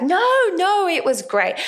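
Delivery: high, loud 'no, no' dropping back; over-protest like a friend being kind; mock insistent reassurance. Friend reassuring an actor after a bad show, insistent.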